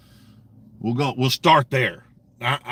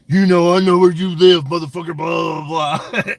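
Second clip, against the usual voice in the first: mock threat; 3 semitones higher, 6 dB louder than usual. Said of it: "Ruff is all threatening him and shit".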